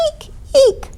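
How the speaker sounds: tiny, very high double squeak; mouse squeak. A narrator's reading. Little mice woken in the stable, high squeaks. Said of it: "squeaked the scampering mice".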